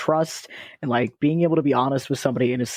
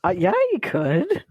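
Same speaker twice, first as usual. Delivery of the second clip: high mock voice. Mocking reply in high voice.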